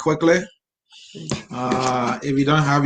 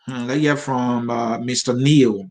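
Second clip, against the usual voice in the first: normal talking voice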